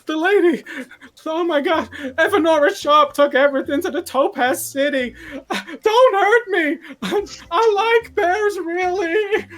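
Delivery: very high, loud, laughing panicked babble; terrified pleading. Acted. Apprentice begging the bears not to hurt him, high and panicked. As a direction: beg for mercy in a squeak.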